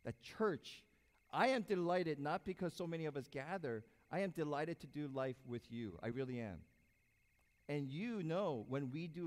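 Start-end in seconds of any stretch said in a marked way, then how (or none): none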